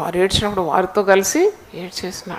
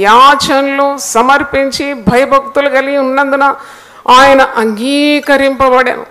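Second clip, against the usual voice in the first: slow, stretched key words in a forceful voice; emphatic scripture reading; 3 semitones higher, 4 dB louder, slower than usual. Real, not acted. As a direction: declaim the verse to the congregation. Declaims scripture to congregation. (లేఖనాన్ని సాగదీస్తూ, గంభీరంగా చదువుతూ)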